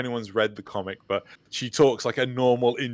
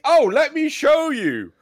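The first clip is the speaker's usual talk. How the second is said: high, loud, eager offer; eager excitement; 7 dB louder than usual. Retold or imitated. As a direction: jump at the chance, voice way up and louder. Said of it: "And it's like".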